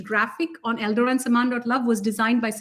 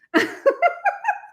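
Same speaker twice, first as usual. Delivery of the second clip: high giddy laugh burst; giddy high-pitched laugh; high giggle. Real, not acted. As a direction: let out a squeal of laughter. Giddy after announcement.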